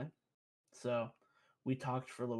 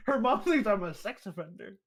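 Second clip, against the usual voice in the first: laughing through the words; loud, high laughing disbelief; 7 semitones higher, 7 dB louder than usual. Real, not acted. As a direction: crack up mid-sentence. Laughs while telling it.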